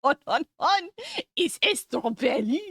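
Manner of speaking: silly exaggerated French accent; mock French accent. Streamer repeating the strawberry meme amid laughs, mock French accent. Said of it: "I got another French meme".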